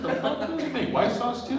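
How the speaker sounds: high-pitched acted astonishment; incredulous. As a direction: blurt it out high, baffled by the offer. Acted surprise at taco vendor.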